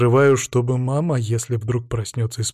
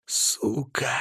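soft, venomous hiss; barely audible hiss; 7 dB quieter than usual. A narrator's reading. Krestovsky curses as his daughter is held back, barely audible hiss. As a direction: hiss it under your breath. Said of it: "шипит еле слышно".

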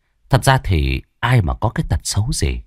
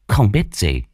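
ordinary delivery, nothing marked

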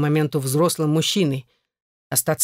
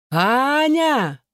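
high, drawn-out shout of a name; calling out loudly; 9 semitones higher than usual. A narrator's reading. Husband yells for his runaway wife, calling out. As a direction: call out to someone running away, voice raised high. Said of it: "заорал он так, что на весь дом стало слышно".

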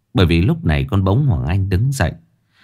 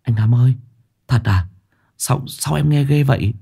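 higher, nervous stammer; frightened; 4 semitones higher than usual. A narrator's reading. Sáu Bưởi turning pale at the demon news, frightened. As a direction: ask timidly, pitch climbing. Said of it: "Sáu Bưởi tái mặt".

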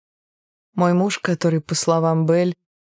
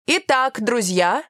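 lightly higher-pitched teacher call; teacher addressing children; 7 semitones higher, slower than usual. A narrator's reading. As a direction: gently call a class to attention. Teacher calls class to attention.

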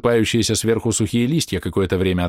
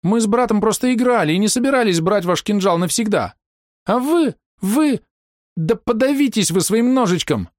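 squeaky offended voice; 13 semitones higher than usual. Acted. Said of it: "обиженно писклявым голосом".

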